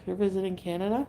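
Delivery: normal talking voice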